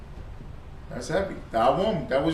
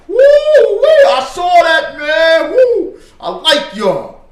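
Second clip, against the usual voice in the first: very high, loud, drawn-out whoops; mock fan whooping; 11 semitones higher, 11 dB louder, much slower than usual. Preacher imitates fans saying I like y'all, whooping excitedly. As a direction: whoop like a thrilled onlooker, hammed up.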